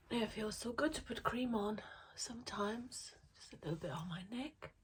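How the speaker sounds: soft unvoiced whisper. Real, not acted. Vlogger applying cream, whispering her pleasure.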